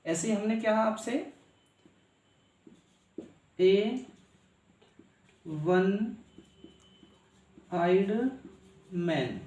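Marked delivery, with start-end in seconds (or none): 3.6-9.4 each word set apart by long pauses; slow teacher's dictation with pauses; spelled out slowly. Shown as a demonstration. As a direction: say it word by word, pausing between. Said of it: "यानी एक आंख वाला आदमी".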